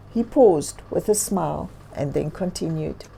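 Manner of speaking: ordinary delivery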